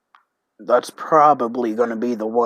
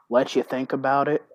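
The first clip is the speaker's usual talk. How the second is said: ordinary talk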